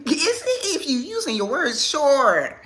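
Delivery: giggly high teasing words; laughing tease. Retold or imitated.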